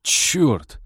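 blurted curse. A narrator's reading. Pilot recognising the announced expert, dismayed blurt. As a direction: let the curse slip out in dismay. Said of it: "вырвалось у Теодора".

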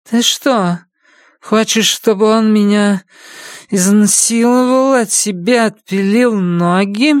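high, dragging female character voice; tongue-tied, dazed woman; slurring woman. A narrator's reading. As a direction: slur it out woozily, pitched high. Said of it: "заплетающимся языком проговорила женщина".

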